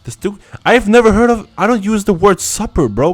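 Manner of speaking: pitched well up, a lightly voiced scoffing bro; mocking dude voice. Scoffing at supper fans in a mock high dude voice. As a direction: play a sneering dude who has never heard of supper.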